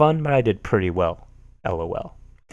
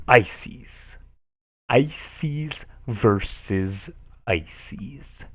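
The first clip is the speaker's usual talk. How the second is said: drawn-out dramatic reveal; 5 dB quieter, much slower than usual. Acted.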